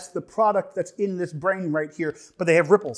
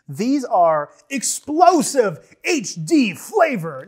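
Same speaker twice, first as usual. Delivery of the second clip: hyped announcer read; 5 semitones higher, 6 dB louder, much slower than usual. Acted. Reading chip bag dramatically.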